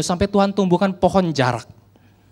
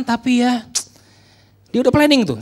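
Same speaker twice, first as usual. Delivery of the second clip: ordinary delivery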